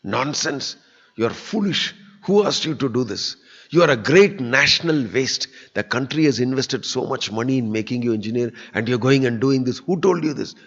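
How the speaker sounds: mock the rich man's furious rebuke; retold angry shouting. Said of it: "He shouted at me".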